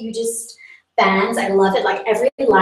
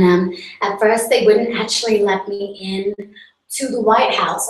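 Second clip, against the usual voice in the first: ordinary talk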